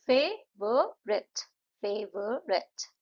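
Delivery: फेवरेट said very slowly twice, फे-व-रेट; syllable beats. Shown as a demonstration. Teacher repeats फेवरेट slowly for pupils. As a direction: stretch फेवरेट into three beats. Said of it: "फेवरेट में तीन".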